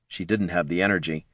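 plain reading voice

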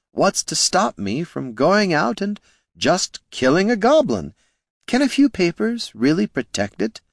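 courteous words with a hidden sneer; smooth gnome Flynn. A narrator's reading. Flynn needling the heroes, mock politeness.

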